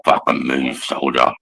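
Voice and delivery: low, hard command to a recruit; low, intimidating barked order; barking drill instructor. Retold or imitated. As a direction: growl it down at him like a drill instructor. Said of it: "you grab his fucking arm and go".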